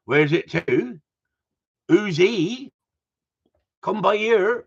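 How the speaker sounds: put-on Cornish lilt, slipping towards Welsh; Cornish accent. Shown as a demonstration. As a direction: have a playful go at a Cornish accent. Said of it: "I can't talk Cornish, can I?".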